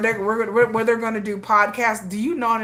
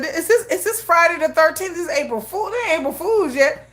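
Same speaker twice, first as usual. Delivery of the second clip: high incredulous; 9 semitones higher than usual. Retold or imitated. Retelling her disbelief.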